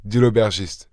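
ordinary delivery, nothing marked

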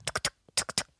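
tiny unvoiced clip-clop; bruit de galop. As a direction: tap out fleeing steps with the mouth. Imitated galloping away. (petit bruit de galop chuchoté)

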